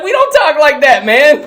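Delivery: high, protesting impression; high mock exasperation; exasperated movie character. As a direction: pitch it way up in protest. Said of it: "He's like".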